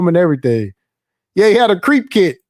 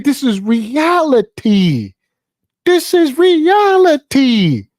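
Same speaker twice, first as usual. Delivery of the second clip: high-pitched, drawn-out insistence on the facts; exasperated insistence; 5 semitones higher, slower than usual. Acted. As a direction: plead the obvious, stretching the key word. Acted exasperated argument with partisans.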